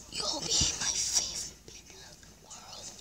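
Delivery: whispered promise. Real, not acted. Kid whispering a promise to subscribers. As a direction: breathe it as a secret.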